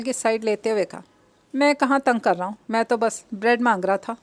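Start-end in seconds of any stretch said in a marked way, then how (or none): none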